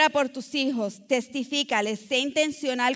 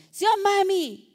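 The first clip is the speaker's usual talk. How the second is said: put-on higher, louder sing-song child's plea; child asking blessing; 7 semitones higher, 3 dB louder than usual. Daughter asking her mother for the blessing, higher sing-song plea. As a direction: ask mami for the blessing like a small child. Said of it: "Así le decía yo a mi mamá".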